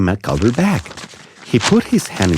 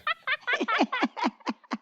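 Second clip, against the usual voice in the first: high, light laughter; shared giggle; 13 dB quieter than usual. Acted. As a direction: giggle over a silly joke. The friends share silly jokes.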